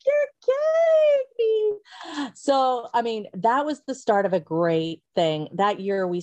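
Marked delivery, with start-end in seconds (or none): 0.1-2.3 very high, drawn-out squeal; ecstatic disbelief. Retold or imitated. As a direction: stretch it out in squealing amazement. Retells stunned delight at huge order.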